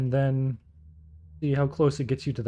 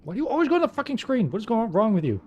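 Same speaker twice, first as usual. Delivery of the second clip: raging gamer; 8 semitones higher, faster than usual. Real, not acted. Streamer raging at screen.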